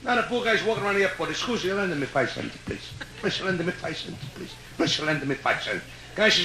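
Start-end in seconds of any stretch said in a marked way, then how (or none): 1.3-5.8 low, soft, pitiful plea for a nickel; small pleading murmur, much softer; quiet begging. Acted. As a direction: whimper it to strangers, much quieter and lower. Guy begs around the airport.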